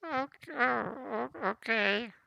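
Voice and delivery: put-on high, faltering old woman; an old woman, flustered, greeting a ghost; frail old voice.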